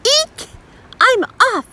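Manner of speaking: very high startled squeal into a call; comic yell. A narrator's reading. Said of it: "yelled Mister Little".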